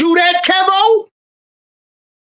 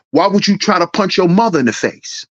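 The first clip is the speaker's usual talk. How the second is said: ordinary talk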